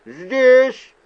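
ordinary delivery

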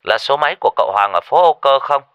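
put-on loud, high, fast caller in accented Vietnamese; non-native Vietnamese accent. A narrator's reading. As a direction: rush the question out in off-accent Vietnamese. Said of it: "giọng nói lơ lớ tiếng Kinh".

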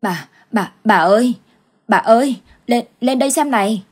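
frightened stammer rising to a loud call; panicked old man stumbling over the words; husband shouting for his wife, scared. Acted. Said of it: "miệng lắp bắp gọi".

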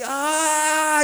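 long, high cry of aching strain; acted pained wail. Preacher imitates hanging brother's aching muscles, drawn-out pained wail. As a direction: wail as muscles start to hurt.

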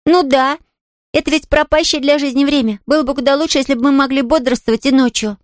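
put-on high, earnest child's voice; musing little girl. Girl telling the invalid her notion about sleep, earnest. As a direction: share an odd idea as a little girl.

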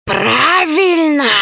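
slow hissing serpent voice; hissing snake. Acted. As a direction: hiss it like a snake. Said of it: "прошипела змея".

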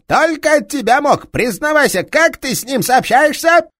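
angry detective shouting down a thief; enraged police inspector. Acted. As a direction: roar at the thief with fury.

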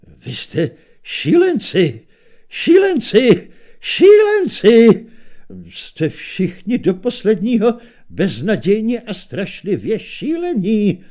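building from stunned to a high slow shout; exploding in disbelief. A narrator's reading. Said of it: "vypravil ze sebe minstrel". (narůstající vyděšený křik)

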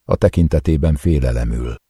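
plain reading voice

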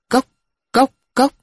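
high clipped tok tok tok of a wooden bell; wooden knock imitation. Crier's wooden bell beating, high vocal knocks.

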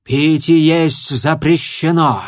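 loud, slow booming dragon; thundering. A narrator's reading. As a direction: thunder the rule out. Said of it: "громыхнула мадам Жаропых".

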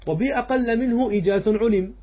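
reciting the verse. Shown as a demonstration. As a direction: lift the voice to announce the verse. Reading the next line of verse. (إنشاد بيت المتن بصوت أعلى)

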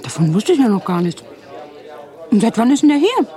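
loud, high-pitched astonished questioning; startled surprise. Acted. Marge learning of the private detective, startled. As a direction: rise sharply in pitch and volume, taken aback.

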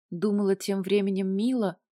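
plain reading voice

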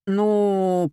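stretched, searching mumble of a slow thinker; drawn-out hesitation. A narrator's reading. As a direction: drag each word out while thinking. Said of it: "протянул Коля". (тянуть в раздумье)

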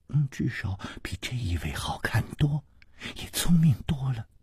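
whispered jab behind his hand; teasing aside. Acted. Page boy whispering a cheeky insult about Hu Tiehua. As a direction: hush the insult so he barely hears.

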